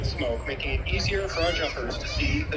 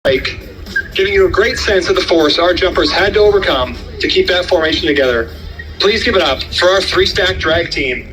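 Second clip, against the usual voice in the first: airshow commentator; 3 semitones higher, 13 dB louder than usual. Acted. Live airshow PA announcing.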